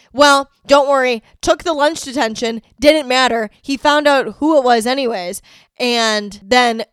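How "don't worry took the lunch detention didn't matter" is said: ordinary talk, nothing marked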